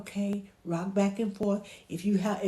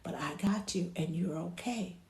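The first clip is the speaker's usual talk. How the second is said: soft, understanding comfort; quiet, steady reassurance; soothing reassurance; 6 dB quieter than usual. Shown as a demonstration. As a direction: gently acknowledge a baby's upset. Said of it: "Let them know".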